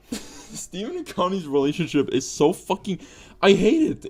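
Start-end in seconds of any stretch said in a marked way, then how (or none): none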